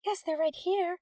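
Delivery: high, lilting call; musical voice. A narrator's reading. Teacher calling to the intercom about students, high and musical. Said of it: "she called in a musical voice".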